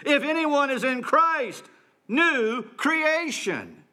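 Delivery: slow, exultant preacher's cry; jubilant proclamation. Shown as a demonstration. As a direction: proclaim it with triumphant joy. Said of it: "that's the emotion that Paul wants to evoke in us".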